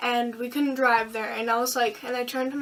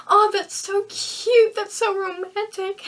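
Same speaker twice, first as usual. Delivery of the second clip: much higher, slow, swooning; gushing friends; 7 semitones higher, 4 dB louder, slower than usual. Retold or imitated. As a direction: coo over it like a gushing friend. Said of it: "they'd be like".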